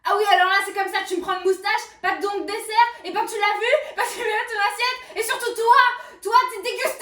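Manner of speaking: loud, high furious cartoon rant; Anger character shouting. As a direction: explode with rage at the table. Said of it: "c'est mieux colère".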